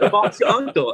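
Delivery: mock British accent pitched high on the first words; a playful stab at a British accent, quoting a friend. Guest shows the hosts his shaky mock British accent. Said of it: "Now we want to hear your British accent".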